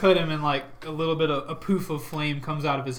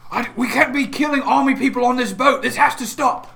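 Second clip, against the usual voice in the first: raised, higher voice laying down the law; loud order; 8 semitones higher, 7 dB louder than usual. Acted. Talazar ordering an end to killing on the boat, loud. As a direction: command the fighting stop.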